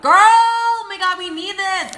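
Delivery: high, loud squeal to friend Lindsay; excited scream. Retold or imitated. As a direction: shriek it like an all-caps text. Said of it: "screaming in all caps".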